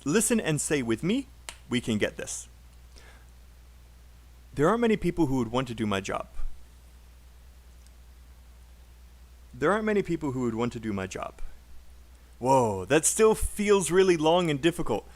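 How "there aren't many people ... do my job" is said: fast linked sentence with "who would" and "to do" tiny; unstressed function words. Shown as a demonstration. Teacher modelling for students to repeat, fast reduced words. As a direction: rattle it off, shrinking "who would" and "to do my". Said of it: "all of these words are unstressed".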